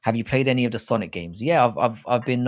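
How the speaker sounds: usual speaking voice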